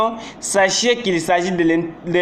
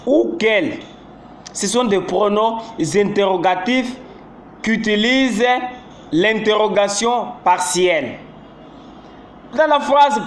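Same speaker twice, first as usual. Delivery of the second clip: ordinary talk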